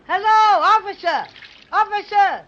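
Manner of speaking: high, loud hail; big-voiced yelling. Bear showing off his big voice to policeman, yelling. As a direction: bellow it to someone far off. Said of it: "let me do the yelling".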